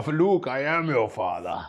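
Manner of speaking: lightly voiced Vader, slow and solemn; a hint of the famous villain reveal; Darth Vader parody. Joking the father quotes Vader, lightly voiced and slow. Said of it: "He doesn't actually say that".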